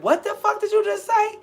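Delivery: high, loud stunned squeal; like the wind was knocked out of you; replaying the moment the bad news landed, high and loud; shocked disbelief. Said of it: "like somebody had knocked the wind out of me".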